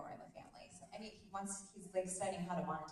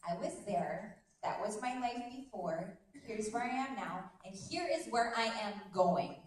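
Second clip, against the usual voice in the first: firm, emphatic declaration building louder; resolve; 2 semitones higher, 6 dB louder than usual. Shown as a demonstration. Coach declaring where she is going, resolute. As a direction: state each step with conviction. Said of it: "Do you hear that difference in my voice?".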